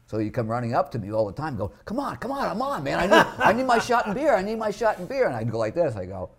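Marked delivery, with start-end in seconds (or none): 1.8-5.3 pitched way up, pleading for a drink; whiny begging. Retold or imitated. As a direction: beg like a desperate drunk, high and whiny. Retold pestering comic begging drink.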